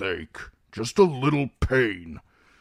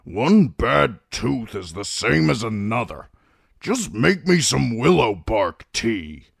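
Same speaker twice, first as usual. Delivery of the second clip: put-on higher, grouchy old man's grumble; grumbling; 4 semitones higher than usual. A narrator's reading. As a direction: grumble as a stubborn patient. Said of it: "Kreb grumbled".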